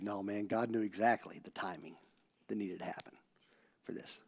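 low, very soft comforting; gentle reassurance. Retold or imitated. Pastor comforting his crying friend, soft and reassuring. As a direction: reassure him quietly, almost fading away.

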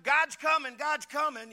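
high, loud proclamation called out; retold shout of a warning; mock shouting preacher. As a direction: cry it out like a street preacher. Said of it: "shouting out".

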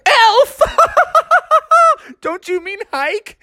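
high, laughing mock surprise; laughing disbelief. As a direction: tease him between laughs. Kids laugh at Froggy.

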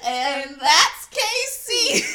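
high, loud, slow announcement; announcer voice. Pretend. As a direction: announce her with a flourish. Mock announcement.

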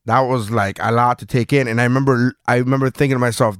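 ordinary talk

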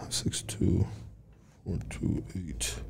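whispered digits read out slowly; whispering. Real, not acted. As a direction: whisper the numbers to yourself while dialing. Dialing a number under his breath.

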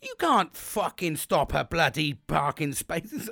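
high, very drawn-out mock rant; hammed-up indignant protest; imitated angry wife. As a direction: stretch out each word in outrage. Wife rounds on woman, re-enacted.

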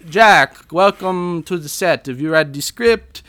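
put-on loud, high vague European accent; Roman Polanski. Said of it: "vaguely European accent".

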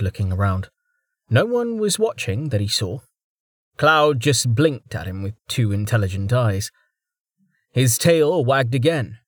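plain reading voice

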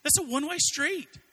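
high, loud imitated wife pointing it out; mock wife, dry correction up high; wife correcting him. Wife telling Max it's one-way, high mock correction. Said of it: "She says".

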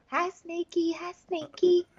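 high, soft mocking greeting; hammed-up taunting hello; taunting singsong. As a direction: tease him in a sweet sneer. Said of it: "He's like".

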